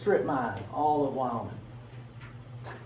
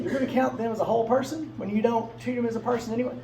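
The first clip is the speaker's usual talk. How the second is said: higher, pointed disbelief; incredulous challenge; 8 semitones higher than usual. Retold or imitated. The opponents calling out the hypocrisy, higher and incredulous. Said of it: "they said to them".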